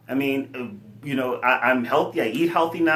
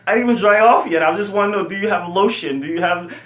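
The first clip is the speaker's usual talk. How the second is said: higher, louder casual brother voice; imitating kid brother; 5 semitones higher, 6 dB louder than usual. Shirtless brother asking for lotion, lightly voiced and higher. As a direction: toss it off like a carefree younger brother.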